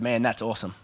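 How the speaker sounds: low, soft throwaway slang; casual everyday awesome. Retold or imitated. Preacher mimicking everyday English speech, casual and throwaway. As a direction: toss it off like an everyday remark. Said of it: "I don't mean awesome in the way that we speak".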